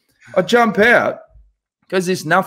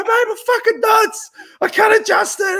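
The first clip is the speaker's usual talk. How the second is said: pained shout. Retold or imitated. Shouting mid-air in pain.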